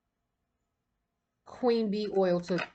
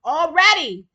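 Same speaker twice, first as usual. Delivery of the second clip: sudden high, loud exclamation; surprised exclaim; 8 semitones higher, 11 dB louder than usual. Real, not acted. Reader reacting to a card, loud and surprised. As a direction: gasp it out in disbelief.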